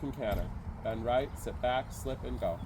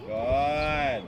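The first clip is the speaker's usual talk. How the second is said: loud praise; 6 dB louder than usual. Real, not acted. Praise after the canter.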